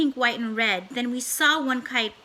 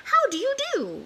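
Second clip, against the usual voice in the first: high, sing-song greeting from the Things; squeaky Thing voice; 12 semitones higher than usual. A narrator's reading. Things greeting the children, high and silly. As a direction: pop up high for a silly hello. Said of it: "They said".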